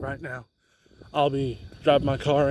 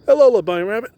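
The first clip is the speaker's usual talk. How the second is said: cooing to a bunny; 5 semitones higher, 5 dB louder, much faster than usual. Real, not acted. Greeting a bunny on the path.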